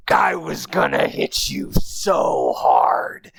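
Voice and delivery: a calm robot of doom savoring the threat; menacing knight. Retold or imitated. Himmel looming over the goblin, low slow menace.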